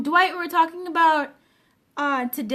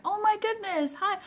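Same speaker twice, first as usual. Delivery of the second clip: a bit higher, at normal volume, a casual hello; light friendly greeting; 6 semitones higher than usual. Retold or imitated. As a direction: say it brightly but lightly. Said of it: "I'm just like".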